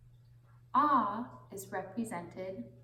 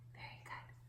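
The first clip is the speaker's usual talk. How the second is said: whispered; 14 dB quieter, faster than usual. Real, not acted. Praising children softly.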